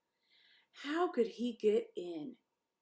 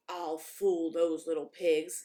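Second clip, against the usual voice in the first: scheming wolf; 3 semitones lower, 7 dB louder, slower than usual. A narrator's reading. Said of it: "said the big bad wolf to himself".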